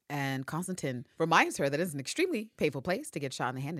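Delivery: normal talking voice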